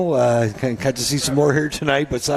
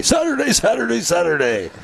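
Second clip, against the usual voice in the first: monster truck ad; 8 semitones higher than usual. Retold or imitated. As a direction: hype it like an old monster truck commercial. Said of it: "you have to say".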